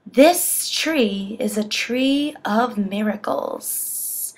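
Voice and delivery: slow, hushed snake hiss. Acted. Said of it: "and hissed".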